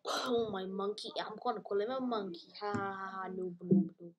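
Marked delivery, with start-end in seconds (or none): none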